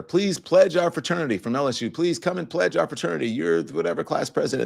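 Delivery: high, pleading mock voice of fraternity recruiters. Fraternity letters read in mock voice.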